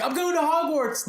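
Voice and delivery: high, thrilled squeal; excited. Pretend. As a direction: gush like a kid off to Hogwarts. Imagined Jake thrilled.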